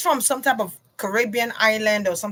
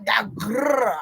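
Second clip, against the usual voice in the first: mock alpha growl. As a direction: growl it like a macho rapper, half joking. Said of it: "like that New York voice".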